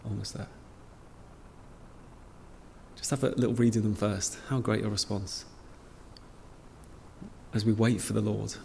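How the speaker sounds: ordinary talk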